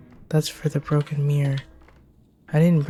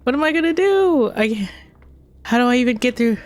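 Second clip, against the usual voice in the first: higher and more frantic each time; rising panic; 6 semitones higher than usual. Real, not acted. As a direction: panic climbing with each question.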